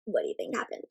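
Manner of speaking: hushed question to the class; whisper. Acted. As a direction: whisper to build suspense. Teacher asks class.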